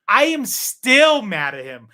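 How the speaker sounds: loud, slow, high-pitched indignation; still mad. Real, not acted. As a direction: drag it out, half laughing, still sore. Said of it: "you can hear it in my voice".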